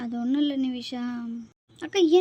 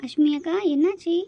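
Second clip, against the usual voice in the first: higher, louder worried questions; alarmed concern; 5 semitones higher, 5 dB louder than usual. Acted. Girl finding her sister in tears, alarmed and louder. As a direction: rush in asking what's wrong.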